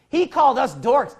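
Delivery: high, loud whiny complaint; mock indignant voice; mock offended dorks. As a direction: whine as offended twisters. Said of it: "and just say".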